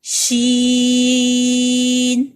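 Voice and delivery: شین slow and loud with a long ee; stretched letter name. Shown as a demonstration. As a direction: draw out شین at full voice. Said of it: "ای کی آواز آئے گی".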